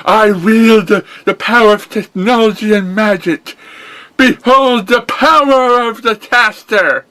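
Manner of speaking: high, loud heroic declaration; boastful hero cry. Acted. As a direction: proclaim your power triumphantly.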